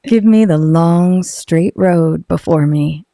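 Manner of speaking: low, slow, unhurried voiceover read of the ad. Shown as a demonstration. Asked by a friend to redo her Harley ad, low and slow. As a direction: draw each line out low and easy like a motorcycle commercial. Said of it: "can you do it".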